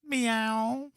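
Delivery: a high drawn-out cat meow; a playful cat sound; mock meow. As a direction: meow like a timid kitten. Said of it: "what them puppies saying?".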